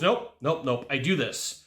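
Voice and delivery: usual speaking voice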